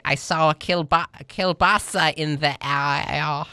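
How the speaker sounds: put-on thick Chicago accent, drawn out; doing a Chicago local. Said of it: "That's my Chicago accent".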